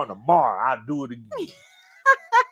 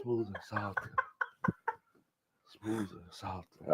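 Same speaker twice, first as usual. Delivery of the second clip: hushed, lowered silky voice; smooth and soft; 5 semitones lower, 15 dB quieter, slower than usual. Retold or imitated. Teasing Shady about his softer voice, hushed and low. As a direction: let it sink very soft and slow down.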